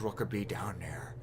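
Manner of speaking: hushed, eerie whisper. Acted. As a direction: whisper it like a secret. Ominous question about ocean depths.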